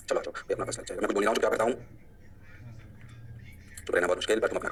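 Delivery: low, soft, half to himself; muttered aside. Real, not acted. Teacher muttering while the class works, low and soft. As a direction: mutter it under the breath.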